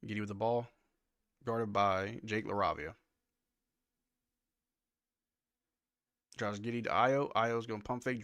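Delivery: brisk live game call; play-by-play. Acted. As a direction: call the action as it happens.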